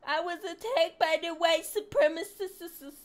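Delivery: mock the claim as a whiner; mock victim voice. Streamer mocking the victim's claim in a whiny voice.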